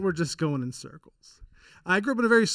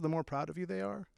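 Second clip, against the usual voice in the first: normal talking voice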